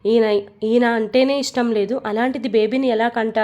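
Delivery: plain reading voice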